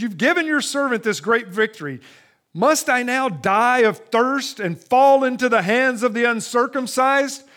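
Samson cries out to the Lord, parched and desperate; crying out. Acted. Thirsty Samson begs the Lord aloud, desperate cry. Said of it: "he cried out to the Lord".

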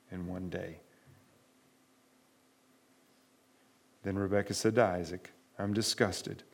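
measured, soft Bible reading; scripture reading. Acted. Pastor reading Genesis to the congregation, soft and measured. Reads scripture passage aloud.

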